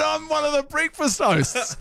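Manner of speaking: high, drawn-out announcement; retold big reveal. Host telling fan who he is, high-pitched reveal. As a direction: stretch out the reveal, pitched right up.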